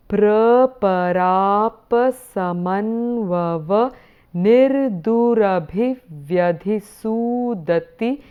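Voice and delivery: Sanskrit verse read very slowly, without a tune; slow, measured recitation in short phrases. Shown as a demonstration. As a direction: draw out each syllable of the verse. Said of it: "reading it very slowly".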